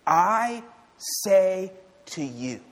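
high, loud "I", held apart; slow, direct proclamation led by a ringing "I"; emphatic declaration. Acted. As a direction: hit "I" hard, pause, then slow "say to you". Jesus addressing the congregation directly.

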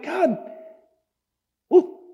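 high, loud, indignant protest; Habakkuk pleading with God in outrage; exasperated prophet. Retold or imitated. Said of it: "He's like".

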